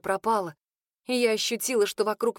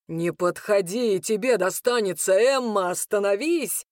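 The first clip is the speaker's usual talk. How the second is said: mild warning shout; 2 semitones lower than usual. A narrator's reading. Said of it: "кричит Хенрик".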